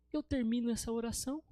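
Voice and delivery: ordinary delivery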